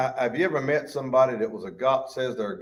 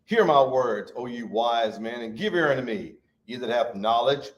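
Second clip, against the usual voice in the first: ordinary talk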